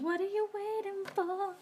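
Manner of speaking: high sing-song voice; playful sticker voice. Pretend. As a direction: read it like a cheeky cartoon sticker. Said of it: "Almost saying".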